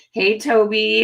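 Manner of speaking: ordinary delivery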